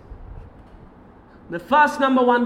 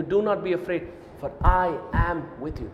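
much lower and softer, intimate; tender hush; 6 semitones lower, 6 dB quieter than usual. Shown as a demonstration. Preacher personally comforting the listener, tenderly. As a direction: say it gently, close. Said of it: "I'm telling you".

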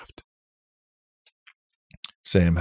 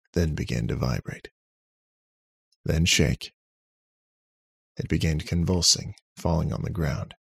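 ordinary delivery

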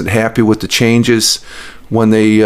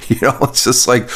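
usual speaking voice

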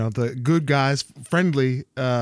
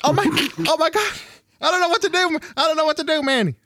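high panicked imitation of sister; freaking out; 12 semitones higher, faster than usual. Sister begging her brother for help, freaking out. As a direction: squeal it fast in a mock panic. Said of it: "She's freaking out".

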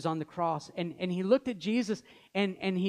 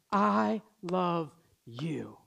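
tender father; much slower than usual. Pretend. Father with son on his lap, saying it slowly and tenderly. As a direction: let each word land like a father holding his son.

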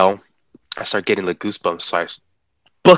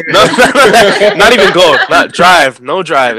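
laughing refusal; 9 semitones higher, 10 dB louder than usual. Retold or imitated.

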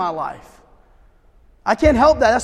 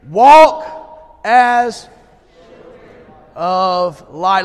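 loud call-and-response; 2 semitones lower, 7 dB louder, much slower than usual. Shown as a demonstration. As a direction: chant it loud with the crowd. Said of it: "Can you say it with me".

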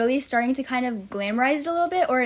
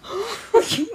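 high, helpless cackle; high laughing fit; 9 semitones higher than usual. Real, not acted. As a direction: crack up uncontrollably. Can't stop laughing.

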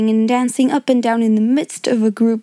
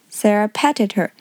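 ordinary delivery

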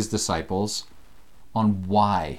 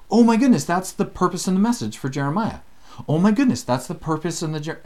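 excited discovery; 6 semitones higher than usual. Retold or imitated. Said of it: "you're gonna go".

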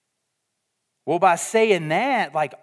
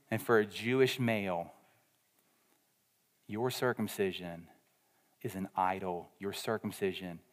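quiet, lower preaching pace; hushed preaching; 8 semitones lower, 10 dB quieter than usual. Acted. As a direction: bring the room in close, quietly. Hushed sermon climax.

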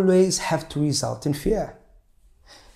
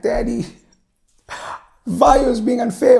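put-on complaining child; 9 semitones higher, 5 dB louder than usual.

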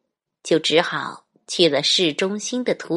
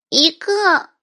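put-on high little kid voice; small child voice; 12 semitones higher, 5 dB louder than usual. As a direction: answer like a tiny child. Small child answers clown.

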